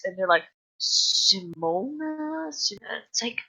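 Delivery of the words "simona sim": slow, drawn-out puzzled reading; slow squinting read. Retold or imitated. Teachers squinting at the name list, slow and puzzled. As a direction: drag out "Simona?" as if decoding. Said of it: "squint as if reading hieroglyphics".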